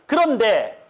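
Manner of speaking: ordinary talk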